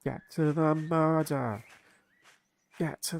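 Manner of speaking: loud, slow, exasperated demand; drawn-out frustrated holler; impatient shout. Real, not acted. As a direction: yell at the game to hurry up.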